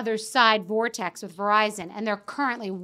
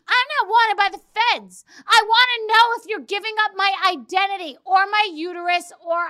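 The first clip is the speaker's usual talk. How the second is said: higher, louder exasperated rant; 7 semitones higher, 3 dB louder than usual. Retold or imitated. Telling off her assistant, exasperated rant. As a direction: let the outrage spill over.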